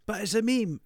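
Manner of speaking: put-on high whiny child insisting; insistent kid.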